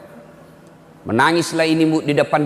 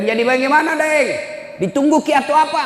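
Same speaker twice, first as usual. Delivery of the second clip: much higher, urgent disbelief; incredulous pressing; 11 semitones higher than usual. Retold or imitated. Preacher pressing the son to come home, in high disbelief. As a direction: push him, stunned he won't come.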